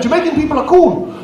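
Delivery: usual speaking voice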